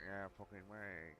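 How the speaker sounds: mumbling. Retold or imitated. Gamer mocking his own unclear talk to viewers, mumbling. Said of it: "I realise that I'm mumbling".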